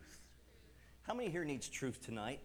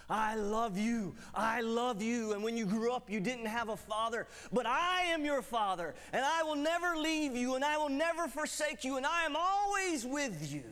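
voice of God; 6 semitones higher, 6 dB louder than usual. Retold or imitated. God declaring love to a fatherless teen, loud and high. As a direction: proclaim it with full force. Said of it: "He said".

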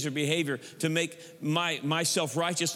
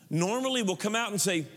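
ordinary talk, nothing marked

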